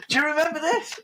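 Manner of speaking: high chat show host question; lightly voiced host, pitched well up; Graham Norton. Host shows guest an old photo, high presenter voice. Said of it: "he'd say something like".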